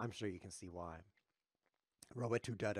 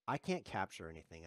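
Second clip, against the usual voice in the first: ordinary talk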